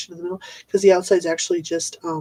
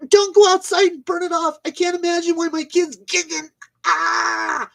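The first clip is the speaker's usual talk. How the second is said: mock clueless parent; 10 semitones higher than usual. Ranting about parents who feed kids sugar, high mocking parent voice. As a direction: ham up an oblivious mom, pitched way up.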